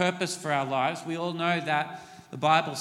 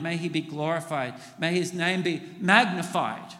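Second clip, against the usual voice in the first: normal talking voice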